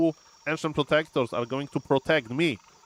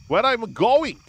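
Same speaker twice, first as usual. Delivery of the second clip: higher and louder, a startled question; alarmed cry; 8 semitones higher, 5 dB louder, slower than usual. Real, not acted. Streamer yelling at his wandering hero, alarmed. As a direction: shout at your hero in disbelief.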